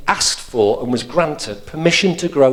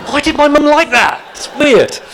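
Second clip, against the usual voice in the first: baffled; 13 semitones higher, 7 dB louder than usual. Real, not acted. Baffled outburst about mum's joke.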